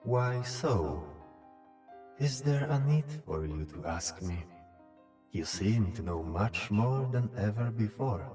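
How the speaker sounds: summoned otherworldly being. Acted. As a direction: question the caller as a knowing spirit. Ming questions Venitus.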